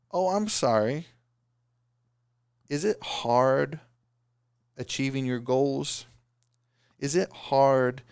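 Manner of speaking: slow, high-pitched mock sympathy; whiny crybaby mockery. Coach mocking listeners who complain, whiny crybaby voice. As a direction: imitate a crybaby, stretching out the words. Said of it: "wanna say the same thing".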